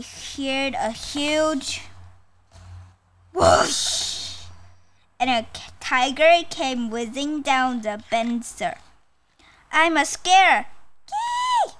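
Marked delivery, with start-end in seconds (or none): 3.3-4.4 breathy, unvoiced whoosh; noisy rushing whoosh; whoosh sound. Imitated noise of a rushing tiger. 9.7-11.7 high squawk with a piercing squeal; story tiger's high-pitched squeal. Acted. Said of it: "the tiger squawked as he flew".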